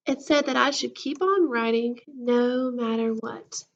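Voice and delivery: ordinary delivery, nothing marked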